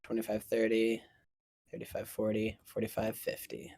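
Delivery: soft, low, even count; counting under breath. Real, not acted. Game master counting enemy movement, under breath. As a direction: count along quietly to yourself.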